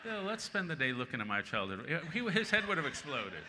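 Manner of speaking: amused disbelief, laughing as he talks. Real, not acted. Telling the audience about his mentor Buckley, laughing.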